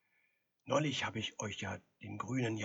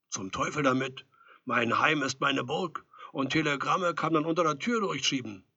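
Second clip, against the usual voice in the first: louder, mildly indignant refusal; defiant declaration; 4 semitones higher, 8 dB louder than usual. A narrator's reading. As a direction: declare it firmly, a bit raised. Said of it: "Ein Mensch mit Charakter würde nun sagen".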